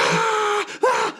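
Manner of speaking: very high pretend labor scream; mock birthing scream. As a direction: scream like a comic woman in labor. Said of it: "She's, like, giving birth".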